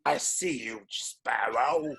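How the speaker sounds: hammed-up Irish pirate voice; mock Irish accent, playfully menacing. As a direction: draw it out like an undead Irish pirate. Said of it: "I just gave him an Irish accent".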